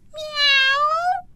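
cat yowl. Acted. Wild animal leaps roaring.

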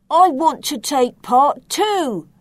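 a muddy pig begging for a turn; eager Pig. Acted. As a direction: pipe up keen to join in. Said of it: "said Pig".

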